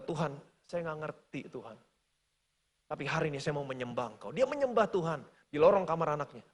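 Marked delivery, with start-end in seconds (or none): 0.0-4.3 much softer, lower humble prayer; father yields to God, soft and humble; softened surrender. Retold or imitated. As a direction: let the anger melt into surrender. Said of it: "mulai melunakkan hatinya".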